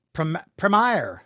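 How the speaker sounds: "Premier" slowly, with a long EYE in the second syllable; corrected "Premier" pronunciation. Shown as a demonstration. Host trying a viewer's correction, "Premier" said prem-EYE-er. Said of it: "how to pronounce Premier".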